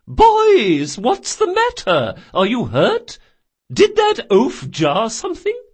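high, loud anxious questions; concerned old woman. Acted. Old shopkeeper to distraught boys, high worried questioning.

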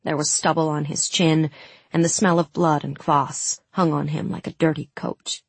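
ordinary delivery, nothing marked

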